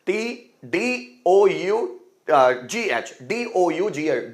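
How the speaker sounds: very slow, letter by letter; slow spelling. Shown as a demonstration. Teacher spelling dough for students. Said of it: "spelling aloud".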